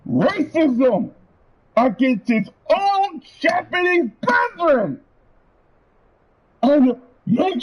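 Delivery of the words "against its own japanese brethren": ordinary talk, nothing marked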